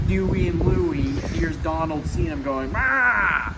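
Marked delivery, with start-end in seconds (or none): 2.7-3.5 higher, louder yell of glee; mock cheer. Donald launching on the skateboard, mock cheer. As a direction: shout it as he kicks off.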